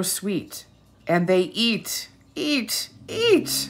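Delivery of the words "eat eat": each eat climbing higher; rising pitch. Acted. Rising eating refrain.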